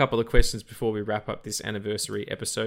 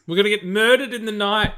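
much higher, louder mock panic; mock alarm; 10 semitones higher, 9 dB louder than usual. Real, not acted. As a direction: cry it out in mock horror. Joking outrage at pick.

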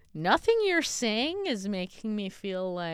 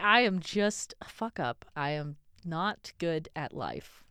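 normal talking voice

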